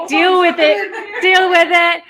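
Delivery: teasing, through a laugh; playful gloat with a chuckle. Real, not acted.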